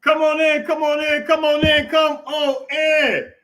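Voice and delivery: loud drawn-out welcome call. Real, not acted. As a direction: call them in, stretching the last one. Host calling viewers into stream.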